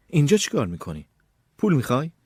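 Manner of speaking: anxious sister confronting an intruder; nervous questioning. A narrator's reading. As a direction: ask warily, pitch lifted. Scared sister questions intruding brother.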